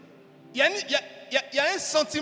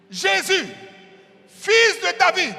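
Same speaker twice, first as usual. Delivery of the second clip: higher and louder, slowed; beggar's cry; 4 semitones higher, 4 dB louder, slower than usual. Acted. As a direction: call out once more to Jesus.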